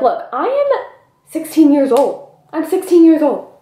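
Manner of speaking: high, emphatic imagined telling-off; exasperated protest. Acted. Imagined telling-off of older man.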